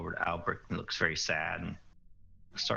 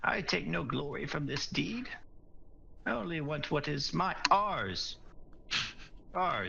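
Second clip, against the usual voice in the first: dwarf voice; 7 semitones higher than usual. Acted. Mim speaks in character.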